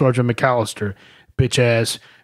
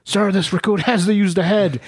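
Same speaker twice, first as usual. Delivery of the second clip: high, meek recruit voice; recruit pleading; 7 semitones higher than usual. Retold or imitated. Recruit begging the drill instructor again, high and meek. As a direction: raise it up small and pleading. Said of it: "the kid's like".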